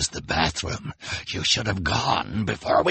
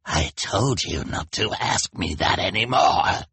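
slow, snarling villain; villain's snarl. A narrator's reading. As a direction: snarl it slowly at a pestering henchman. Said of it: "replied Olaf with a snarl".

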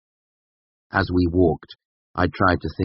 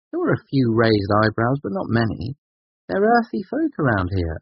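higher voice, gently mocking his all-or-nothing; young woman; 7 semitones higher than usual. A narrator's reading. Woman ribs her friend about wanting answers, lightly voiced.

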